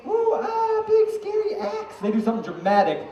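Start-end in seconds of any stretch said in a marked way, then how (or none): 0.1-2.0 high, slow mock-awed ooh and ah; mock awed audience. Juggler mimicking the audience: high mock awe. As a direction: ham up the crowd's fake wonder. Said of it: "the audience is like".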